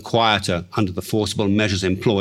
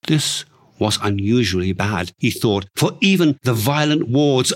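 ordinary delivery, nothing marked

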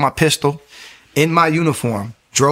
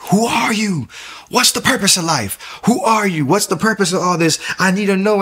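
retold desperate screaming; 8 semitones higher than usual. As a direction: scream the questions up into the night, pleading. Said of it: "just screaming".

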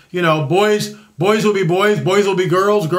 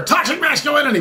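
mock shrill cry; 8 semitones higher, faster than usual. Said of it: "these people screaming over here".

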